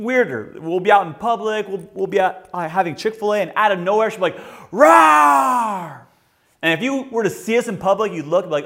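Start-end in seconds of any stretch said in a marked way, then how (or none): 4.7-6.0 high, loud playful roar; monster roar. Retold or imitated. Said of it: "Mike Wazowski".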